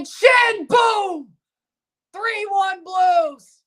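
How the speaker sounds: high, slow triumphant yell; real fan shouting the score; goal celebration shout. Real, not acted. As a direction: roar it out for the goal. Celebrating Schenn goal on replay.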